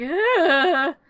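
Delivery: drawn-out, high "ugh"; loud indignant groan. Retold or imitated. Sally being yelled at, groaning loudly. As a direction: let out a big hammed-up groan.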